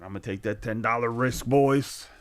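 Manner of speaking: hammed-up cocky quote, rising to a shout; loud bold gambler. Viewer betting ten dollars, quoted as a loud brag. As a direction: play the bragging big spender, loud. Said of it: "He said".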